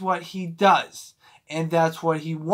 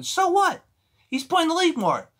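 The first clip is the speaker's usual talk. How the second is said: exasperated; 12 semitones higher than usual. Real, not acted. Exasperated rant about LeBron's points.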